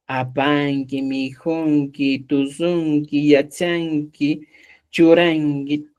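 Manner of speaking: drawn-out, evenly paced verb list; slow drill. Shown as a demonstration. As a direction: stretch each word out for the learners. Teacher lists verb endings slowly. (repaso lento, alargando cada palabra)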